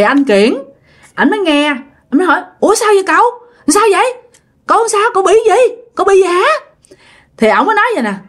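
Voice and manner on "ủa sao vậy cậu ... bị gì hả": high-pitched, worried rapid-fire questions; acted nephew startled by a plea for help; alarmed questioning. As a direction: ask anxiously, pitch jumping up. Nephew answers frightened uncle's call.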